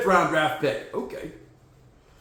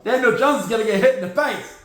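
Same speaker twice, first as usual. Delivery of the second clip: usual speaking voice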